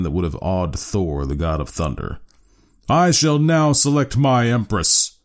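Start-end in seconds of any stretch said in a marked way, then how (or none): 2.9-5.1 slow, high, grand declaration; regal announcement. A narrator's reading. Harrison choosing an empress, regal and slow. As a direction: announce it like a king. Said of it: "he said, looking down".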